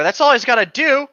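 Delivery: ordinary talk, nothing marked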